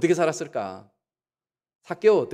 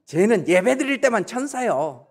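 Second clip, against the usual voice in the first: sarcastic gossip; 6 semitones higher, slower than usual. Retold or imitated. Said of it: "또 뭐라고 얘기를 해요".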